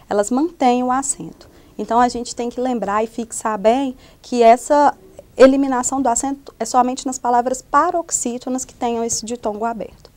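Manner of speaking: normal talking voice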